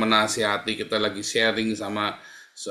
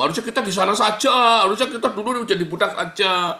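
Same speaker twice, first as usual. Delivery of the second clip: higher, louder whining grumble; complaining Israelites; 8 semitones higher, 4 dB louder than usual. Retold or imitated. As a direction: whine like ungrateful wanderers wishing for slavery. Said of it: "Di tengah jalan mereka ngeluh". (orang Israel mengeluh di padang gurun, merengek keras dan tinggi)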